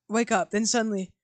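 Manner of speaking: ordinary delivery, nothing marked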